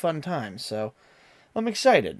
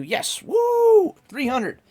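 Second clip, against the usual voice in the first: high, bright little whoop; excited cheer; 13 semitones higher, 6 dB louder, much slower than usual. Real, not acted. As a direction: cheer for the milestone. Celebrating three hundred followers.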